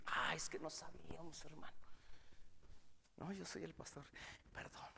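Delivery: whispered, sheepish apology; embarrassed whisper. Retold or imitated. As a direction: breathe it like caught out. Visitors embarrassed, retold.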